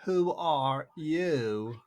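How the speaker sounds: slow, drawn-out scornful question; contemptuous caterpillar. A narrator's reading. Caterpillar sneering at Alice, slow and contemptuous. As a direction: look down on her with each word. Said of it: "said the caterpillar very contemptuously".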